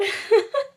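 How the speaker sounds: high, loud burst of laughter; shrill laugh. Real, not acted. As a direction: cackle in disbelief. Reacting to book ending.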